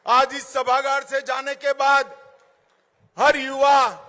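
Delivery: loud rousing rally call; booming political rally speech. Acted. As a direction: fire up the crowd.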